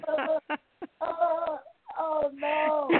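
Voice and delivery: high laughing groans. Real, not acted. Caller laughing at the host's joke, high laughing oh no. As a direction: moan through laughter, oh no.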